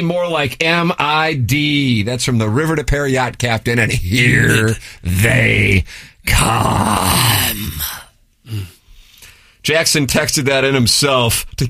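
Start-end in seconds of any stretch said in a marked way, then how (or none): 0.0-2.0 drawn-out taunt. Acted. Acted reading of taunting text. 3.8-7.7 very slow, stretched-out reading; drawn-out hype. Acted. Host hamming up a listener's text, slowly drawn out.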